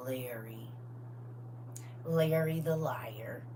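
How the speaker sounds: breathy hushed whisper, drawn out; whispered nickname. Retold or imitated. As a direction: lean in and whisper the nickname slowly. Naming the lying man.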